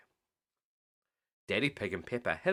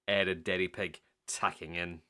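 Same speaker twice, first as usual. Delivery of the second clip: usual speaking voice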